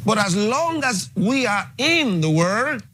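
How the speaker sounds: rising fervor. Real, not acted. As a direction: build up, end high on world. Preacher building to climax.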